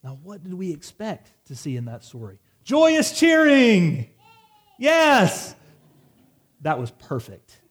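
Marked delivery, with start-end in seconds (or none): 2.6-5.6 high, loud, slow whoop of celebration; mock joyous cheer. Preacher acting out the sailors' expected joy, a loud high cheer. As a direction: cheer like the crowd at a victory.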